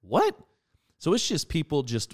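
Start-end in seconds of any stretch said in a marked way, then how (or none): 0.0-0.4 high, loud, rising "what?"; startled, much higher and louder; incredulous reaction. Retold or imitated. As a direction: blurt it out in retold disbelief. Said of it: "I was like".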